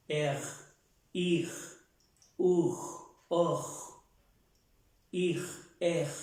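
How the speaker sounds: throaty French R, like gargling; French R with vowels. Shown as a demonstration. Teacher drills the class on gargled R. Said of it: "así, con las vocales".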